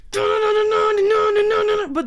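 high, loud, drawn-out wailing yell; hammed-up shouting rock wail; mock rock scream. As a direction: belt it like a screaming rock amp. Imitating blasting guitar noise.

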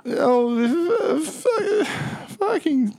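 high, tearful mock stammer; hammed-up high whimper; blubbering. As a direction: choke on the words in an acted sob. Blubbering captive caught out.

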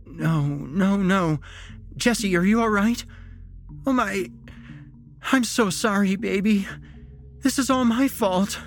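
high, anxious pleading rising to a cry; distraught mother. A narrator's reading. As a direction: panic mounting as you find your son. Distraught mother finds her son.

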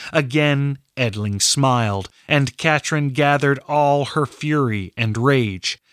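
ordinary delivery

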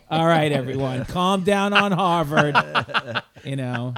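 loud, slowed, laughing mock scolding; loud, drawn-out joking scold; mock exasperation. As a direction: pretend to rein in the hype. Joking reaction to cookie kid.

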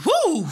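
high loud woo; excited whoop. Real, not acted. Host cheers over her trip, loud whoop. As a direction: whoop with joy.

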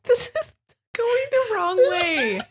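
high-pitched laughing through words; crying with laughter, protesting. Real, not acted.

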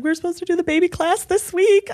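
high, flustered voice; mock panic. Telling the doctor about the missed class, high and frantic. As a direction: pitch it up like a frantic new mom. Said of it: "I was like".

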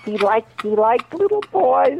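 high-pitched, drawn-out gossipy confiding; June Foray impression. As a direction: put on a high voice, slow on the key words. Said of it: "And he said".

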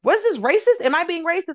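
asking herself in high-pitched shock; baffled disbelief. Retold or imitated. Retelling her reaction at work, high incredulous disbelief.

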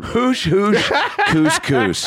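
high giggly German gibberish; mock German accent. Host teasing co-host's German moans, laughing mock German accent.

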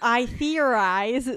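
slow, loud, self-important; pompous declaration. Shown as a demonstration. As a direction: announce it like a great philosopher. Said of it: "Shut the fuck up".

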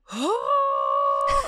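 sudden high gasp of pain; sharp high gasp. Retold or imitated. Reliving contraction.

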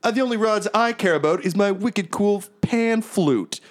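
high, preening bard voice; Brave Star. Acted. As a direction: boast like a self-styled star. Bard boasts about his flute.

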